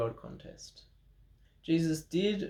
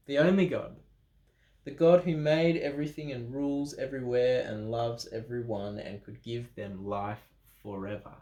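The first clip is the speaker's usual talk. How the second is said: usual speaking voice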